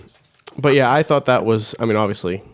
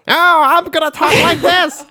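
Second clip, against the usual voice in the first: much higher, slower, louder put-on nerd voice; ridiculous scientist voice; 5 dB louder than usual. Mocking the movie's scientists' voice, ridiculous. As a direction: ham up a silly scientist. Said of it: "he has the most ridiculous voice".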